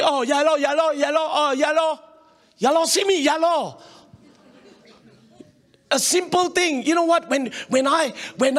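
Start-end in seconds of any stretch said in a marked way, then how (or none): 0.0-2.0 higher-pitched imitation of eager agreeing; mock agreement. Mimics people agreeing with gossip. 2.6-3.8 higher-pitched scornful comeback; mock retort. Throws the agreement back scornfully.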